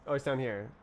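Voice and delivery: usual speaking voice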